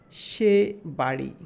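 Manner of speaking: deliberate teacher example; slow example phrase. Shown as a demonstration. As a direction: read "সে বাড়ি" out slowly as an example. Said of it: "বাংলাতে একটা বাক্য দেখি".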